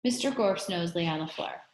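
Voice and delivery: plain reading voice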